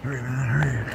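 ordinary delivery

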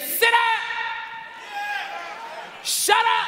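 commanding shout. Acted. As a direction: bark it like calming a storm. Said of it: "He arises and he says".

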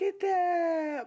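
high, slow, drawn-out marvelling; delighted squeal. Real, not acted. Delighted at seeing her matinee envelope.

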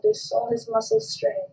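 usual speaking voice

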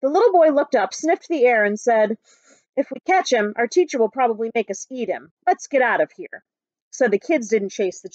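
put-on lively read-aloud for children; story narration. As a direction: tell it like a picture book to a class. Teacher reads picture book aloud.

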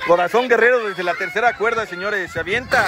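lively ringside play-by-play; wrestling commentator. Pretend. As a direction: call the match like a lucha announcer.

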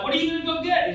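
high-pitched excited dog voice; playfully excited pet. Acted. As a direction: voice an eager dog, pitched well up. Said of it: "he gets all excited".

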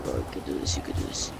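whispered pep talk; breathy encouragement under the breath; whispering. Real, not acted.

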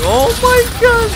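very high, long-drawn, loud omg; a drawn-out falsetto omg; shocked squeal. Real, not acted. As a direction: squeal it out in disbelief. Sudden shock in game.

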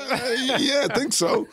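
high-pitched, reluctant retold yes; stall, then squeak out an unsure yes; hesitant agreement. Matt answering Chad's challenge, hesitant and high-pitched. Put on the spot, retold reply.

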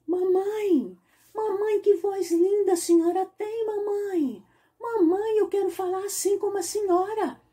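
high, excited duckling calling mommy; duckling amazed by his mother's voice; eager little duckling. Acted. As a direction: beg mommy in a tiny high voice.

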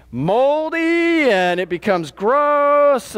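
high, long-drawn-out words of revulsion; exaggerated disgust. Shown as a demonstration. Preacher describing a stinking sponge to church, drawn-out disgust. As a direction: stretch each key word in hammed-up disgust.

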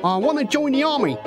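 quick, high, keen lad; eager boy recruit. Acted. Boy asking to enlist afresh, quick and eager. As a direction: rattle it off brightly, high-voiced.

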